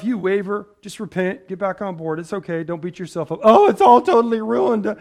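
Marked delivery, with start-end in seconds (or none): 3.4-5.0 loud, high mock wail; mock despair. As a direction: overdramatic panic, pitched up and loud. Mimicking someone beating themselves up.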